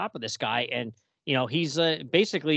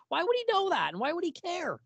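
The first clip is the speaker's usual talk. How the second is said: exasperated disbelief; 4 dB quieter than usual. Real, not acted. Mocking disbelief at the story.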